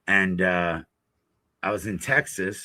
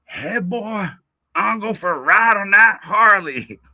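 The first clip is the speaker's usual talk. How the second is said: high mock girl voice asking for a ride; imitating the girl; 8 semitones higher, 7 dB louder than usual. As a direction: put on a high, teasing girl's voice.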